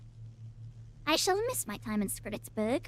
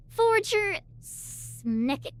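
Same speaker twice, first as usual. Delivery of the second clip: drawn out name; 7 semitones higher, much slower than usual. Acted. Reading NPC name slowly, drawn out.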